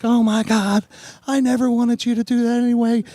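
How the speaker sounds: mimicked girlfriend protesting she never asked; imitating girlfriend. Girlfriend voiced in retold story.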